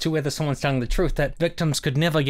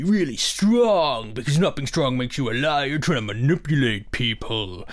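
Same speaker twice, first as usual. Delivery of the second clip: sarcastic mockery. Sarcastic mock of critics.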